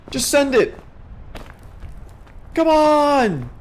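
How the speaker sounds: high, loud, frantic yelling; excited shrieking. Real, not acted. Spots steam, calls to viewers.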